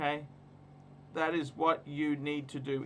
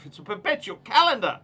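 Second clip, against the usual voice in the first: higher, louder insistence; raised voice, hammering each word; emphatic loud; 7 semitones higher, 10 dB louder than usual. Real, not acted. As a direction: drive the point home loudly.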